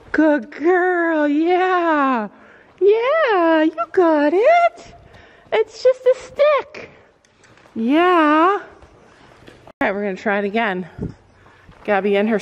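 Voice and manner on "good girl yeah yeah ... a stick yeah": high soothing pony voice. Real, not acted. Owner calming pony near the stick, high coaxing praise. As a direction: reassure a frightened animal up high.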